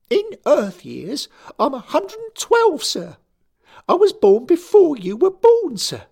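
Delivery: a furious choirboy insisting he's grown; angry schoolboy. A narrator's reading. Derek protesting to his boss, high indignant boy.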